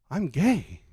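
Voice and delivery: a lightly put-on Tokyo accent, said proudly. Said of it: "What's this accent that you're giving him?".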